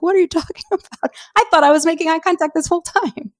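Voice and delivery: high-pitched, mock-indignant disbelief; incredulous protest. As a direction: protest in baffled, laughing disbelief. Retells protesting the evaluator's remark.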